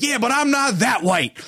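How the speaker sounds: high, slow protest; defensive retort. Retold or imitated. Storyteller answers the offended kid back, defensive. His retort in the retold quarrel.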